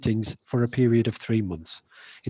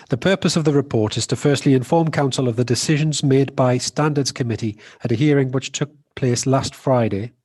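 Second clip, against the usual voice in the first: louder, clearly projected voice; speaking up; 6 dB louder than usual. Real, not acted. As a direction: project your voice to be heard. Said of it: "Could you speak up".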